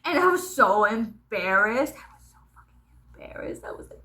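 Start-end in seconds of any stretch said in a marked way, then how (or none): 1.9-4.0 breathless whisper, all air. Real, not acted. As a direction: drop to a whisper of shame. Recalling embarrassment, voice drops away.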